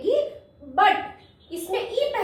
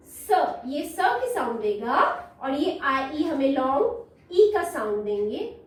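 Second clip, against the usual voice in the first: ordinary talk, nothing marked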